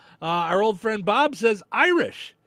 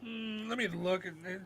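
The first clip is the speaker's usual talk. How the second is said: mock judging contestant. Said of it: "So they're just standing there going".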